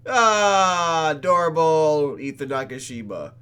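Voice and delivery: loud swooning sigh over a name; gushing. Real, not acted. Player gushing over the cute character, loud and swooning. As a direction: fangirl squeal over him. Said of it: "He was adorable".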